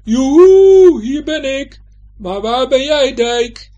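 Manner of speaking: high, very loud drawn-out call; full-voiced high calling out; loud shouting. A narrator's reading. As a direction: holler across a wide open distance. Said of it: "roept hij nog maar eens flink hard".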